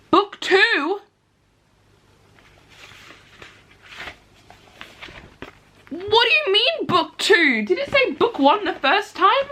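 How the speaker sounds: can't believe she missed it; high, disbelieving what-do-you-mean; incredulous. Real, not acted. Confused about book numbering.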